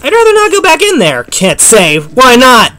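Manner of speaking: high, loud panicked yelling. Real, not acted. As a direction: yell in panic while fleeing. Fleeing a monster in game.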